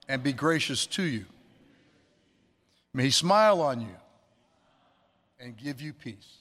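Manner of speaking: measured, solemn blessing; benediction. Acted. Pastor blesses the congregation in a solemn, measured voice.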